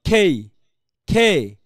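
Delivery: "খেই" loud and drawn out; aspirated K. Shown as a demonstration. Teacher models the letter name for students, aspirated K. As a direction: stretch "খেই" with a puff on the K. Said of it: "এটাকে বলতে হবে কি".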